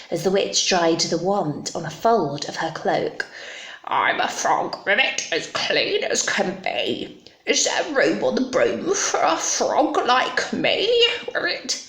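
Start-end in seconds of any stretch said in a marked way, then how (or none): 3.8-11.8 croaky frog voice with little ribbits; croaking frog. Acted. Frog asks the witch for a ride, croaky voice. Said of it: "said with a croak".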